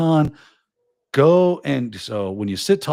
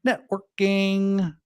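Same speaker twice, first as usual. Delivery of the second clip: put-on slow, drawn-out reveal to the kids; a hint of a schoolteacher drawing out the word; teacher reveal; 6 semitones higher, much slower than usual. Shown as a demonstration. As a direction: spell out the answer like a teacher to kids. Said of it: "guess what that's called, kids".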